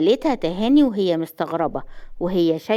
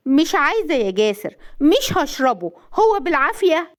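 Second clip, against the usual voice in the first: higher-pitched, wary protest; suspicious refusal; 6 semitones higher than usual. A narrator's reading. Wife refusing suspect juice, defiant. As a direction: dig in, distrusting him. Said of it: "بصت له بشك وقالت".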